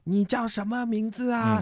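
put-on very high, slow little-kid voice; small child's voice. Five-year-old asking a new playmate's name, high and childish. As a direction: imitate a tiny child who can't yet speak clearly. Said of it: "讲话也讲不清楚".

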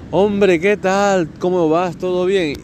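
put-on squeaky, too eager reply to an old friend; overeager reply. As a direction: gush back, thrilled he called.

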